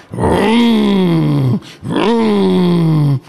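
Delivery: loud revving engine noise; mock engine roar; engine vroom. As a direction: rev it like a motor with your mouth.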